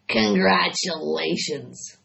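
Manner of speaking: slow sleepy rat murmur; barely bother to say it. A narrator's reading. Rat congratulating Charlotte, murmuring. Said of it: "murmured Templeton".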